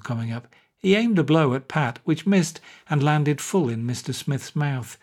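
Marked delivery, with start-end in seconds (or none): none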